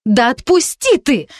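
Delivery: high, slow, stressed on отпусти; furious demand. A narrator's reading. Girl dragged into the room, demanding he let go. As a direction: yank free, demanding.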